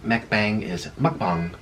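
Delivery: mukbang with ah in the second syllable; careful, slow mukbang; Korean-style mukbang. Shown as a demonstration. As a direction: say mukbang slowly as muk-bahng. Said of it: "'cause that's how they say it".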